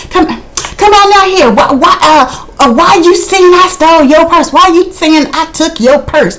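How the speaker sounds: mock Tammy. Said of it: "she's banging on the door".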